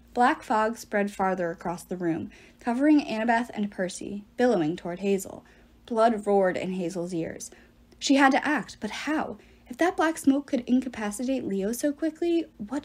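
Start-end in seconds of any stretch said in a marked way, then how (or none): none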